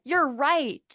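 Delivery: mock child agreement; imitating a kid. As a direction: play the kid conceding. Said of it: "Then they're like".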